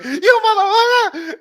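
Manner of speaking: high, loud, laughing mock outrage; shocked yell. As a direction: shriek it like a furious mom through laughter. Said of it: "She's like".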